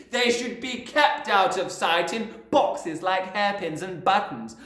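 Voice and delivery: loud, high, scornful rant; ranting headmistress. A narrator's reading. Headmistress lecturing the class, scornful. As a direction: sneer at small people.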